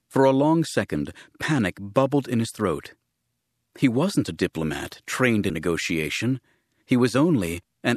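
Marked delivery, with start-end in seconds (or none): none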